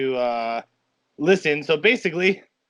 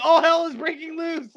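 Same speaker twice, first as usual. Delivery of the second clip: excited; 11 semitones higher than usual. Retold or imitated. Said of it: "that's all I screamed".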